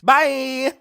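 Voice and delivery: loud, high-pitched bye; shouted sign-off. Acted. Host pretends to end the video, shouted sign-off. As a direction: belt out a sudden farewell.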